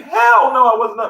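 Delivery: retold indignant yell; indignant shout. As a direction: blurt it out in outrage. Indignant retort in story.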